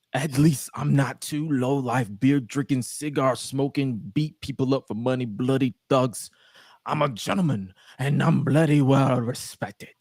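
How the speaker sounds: hammed-up posh English gentleman; bad English accent. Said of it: "butcher the hell out of the English accent".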